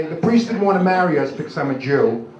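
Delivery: usual speaking voice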